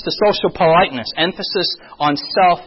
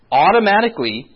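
ordinary delivery

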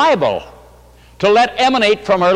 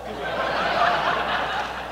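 short unvoiced laugh; breathy laugh; 9 dB quieter than usual. Real, not acted. Preacher laughing at the wife's blunder. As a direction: let a quiet laugh slip out.

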